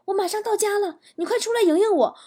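high, urgent pleading voice; acted panic on the phone to dad; frightened daughter. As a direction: beg in a high, scared voice. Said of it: "好害怕".

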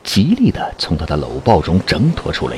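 usual speaking voice

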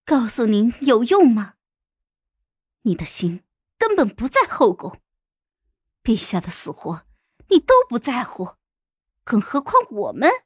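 bitter, scornful challenge; mocking. A narrator's reading. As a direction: throw the accusation back with sarcasm. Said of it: "讥讽地看着我".

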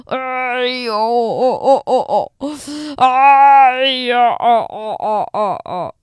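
loud drawn-out fake wail breaking into sobs; wail, then sob in falling bursts; fake crying. A narrator's reading. Wolf covers his face with his paws, fake crying. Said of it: "假装哭起来了".

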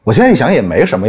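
ordinary talk

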